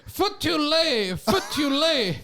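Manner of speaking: high, slow Indian English teacher drill; teacher voice with a put-on Indian accent; mock Indian accent. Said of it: "the Indian teacher".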